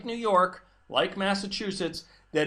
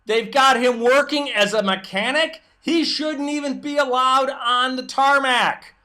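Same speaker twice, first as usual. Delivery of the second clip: raised, louder angry tirade; heated rant; 6 semitones higher, 6 dB louder than usual. Real, not acted. Host ranting to listeners about airport workers, raised and loud. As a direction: climb up in pitch and hammer it loud with real outrage.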